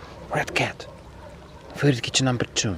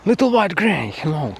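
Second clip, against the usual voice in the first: high cat-calling voice; 7 semitones higher, 4 dB louder than usual. Real, not acted.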